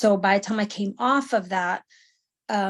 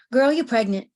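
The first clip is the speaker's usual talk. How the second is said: blunt, a bit louder and higher; sassy friend; 3 semitones higher, 5 dB louder than usual. Retold or imitated. Sergeant tells her friend she's pregnant, blunt and sassy. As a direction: drop the news matter-of-factly. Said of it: "And she goes".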